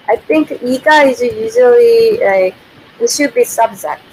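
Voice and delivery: ordinary delivery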